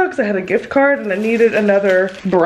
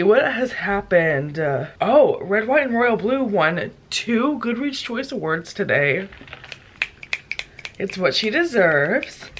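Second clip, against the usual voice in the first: usual speaking voice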